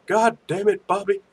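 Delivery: mock-exasperated character catchphrase; Hank Hill. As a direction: scold the boy in a put-on twang. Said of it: "That was a great Hank Hill".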